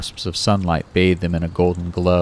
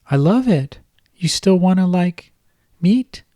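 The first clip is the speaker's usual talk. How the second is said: much higher girlish voice with a hint of a man underneath; a grown man faking a little girl, pitched way up; babyish high voice; 12 semitones higher than usual. Kyle faking Kate's voice on the phone to Ron, high and babyish. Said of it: "his Cuddly Kate voice holding".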